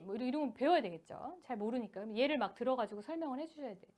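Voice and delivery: ordinary talk, nothing marked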